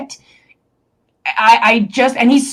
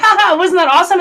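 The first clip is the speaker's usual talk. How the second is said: delighted; 7 semitones higher, 4 dB louder, faster than usual. Retold or imitated. Quoting her delighted dad.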